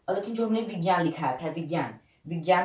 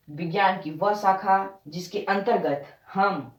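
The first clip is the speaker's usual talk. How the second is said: slow dictation. Shown as a demonstration. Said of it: "तो अब हम इसे ऐसे पढ़ लेते हैं".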